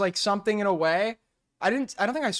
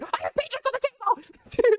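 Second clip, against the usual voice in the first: falsetto plea breaking into laughter; high laughing plea; slower than usual. Acted. Friend mock-pleading in a high voice, laughing. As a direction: squeak it out high while cracking up.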